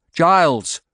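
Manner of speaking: loud alarmed cry of a name; cried out. A narrator's reading. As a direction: call out his name in alarm. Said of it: "I cried".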